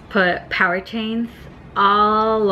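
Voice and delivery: speaking with lips held; fingers pressed on lips. Real, not acted. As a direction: lip corners pressed by fingers. Seen 1: index fingers touch lip corners throughout; not before -1.0 or in refs.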